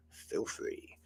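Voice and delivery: breathy cheeky whisper; conspiratorial whisper to the viewers; playful whisper. Real, not acted. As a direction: whisper it as a hint. Cheeky aside asking for cake.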